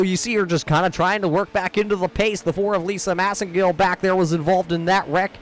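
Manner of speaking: ordinary delivery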